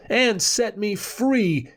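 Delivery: high, slow request; a lightly voiced ghost asking to be freed, slow and high; ghostly advice. A narrator's reading. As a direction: ask it slowly from far away. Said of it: "Hef advised".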